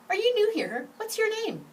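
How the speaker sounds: put-on a little girl quizzing the newcomer; inquisitive girl. Girl on bus questions.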